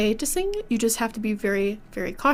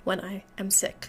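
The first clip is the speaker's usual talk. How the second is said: ordinary talk, nothing marked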